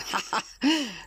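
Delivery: short forced laugh up high; a brave little laugh that covers fear; nervous laugh. Acted. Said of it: "sprach jetzt der mutige Schreiner".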